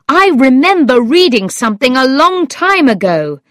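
animated storybook exclamation; exclaiming. A narrator's reading. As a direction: keep crying out as it comes back. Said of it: "he cried".

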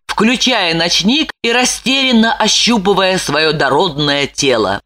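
ordinary delivery, nothing marked

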